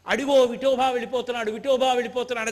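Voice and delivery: a retold saint crying out loud; retold shout. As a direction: call out urgently to the sky. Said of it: "అని అరిచారు".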